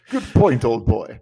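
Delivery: loud mock-British approval; hammed-up congratulation, falling at the end; approving old-chap voice. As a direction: play an impressed listener, hearty and loud. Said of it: "and you'd say".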